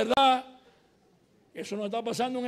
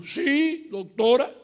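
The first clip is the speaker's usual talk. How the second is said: a hint of villager, raised pitch; imitated villager; 4 semitones higher, 5 dB louder, much slower than usual. Farmer answering the visiting professor about progress, lightly imitated reply. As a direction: lightly mimic the answer, higher and louder. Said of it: "Y él hizo así".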